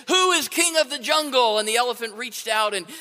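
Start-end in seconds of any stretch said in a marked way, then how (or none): 0.1-1.6 higher, louder lion roar; bellowing lion. A narrator's reading. Lion demanding an answer from the elephant, loud roar. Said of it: "he said".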